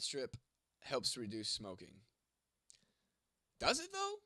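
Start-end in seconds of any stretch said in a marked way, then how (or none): 3.6-4.1 skeptical. Real, not acted. Doubting a chat claim.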